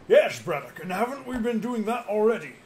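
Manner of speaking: angry retort. Acted. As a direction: push back hard at the dwarf. Character answers back sharply.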